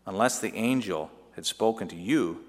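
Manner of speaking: ordinary delivery, nothing marked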